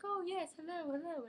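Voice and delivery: high, bright mock nurse greeting; chirpy nurse. Mocking the nurse's chirpy hello at the dentist. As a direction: sing out hello like an overeager nurse.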